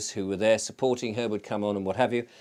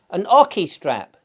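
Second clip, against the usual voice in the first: put-on higher and louder Australian accent, insistent; Barbara's Australian accent; 7 semitones higher, 8 dB louder, slower than usual. Barbara O'Neill repeating her question, louder Australian accent. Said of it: "'Cause she's Australian".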